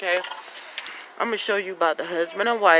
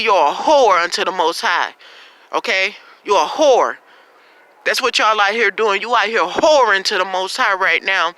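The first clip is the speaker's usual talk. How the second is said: louder, rising denunciation; fiery preaching; 2 semitones higher, 4 dB louder than usual. Acted. Preacher condemning viewers for idol worship, loud fiery preaching. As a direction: build into a thundering rebuke.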